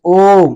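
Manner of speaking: loud, long-held ओम; drawn-out vowel. Shown as a demonstration. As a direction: hold the ओ in ओम, loudly. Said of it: "ज्यादा समय लग रहा है इसको बोलने में".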